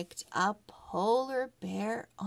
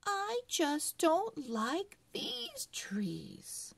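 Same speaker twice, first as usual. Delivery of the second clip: put-on higher and slow, a pouty small girl; little girl voice; 9 semitones higher, slower than usual. A narrator's reading. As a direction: play Emily sulking over the trees. Girl Emily voiced in story.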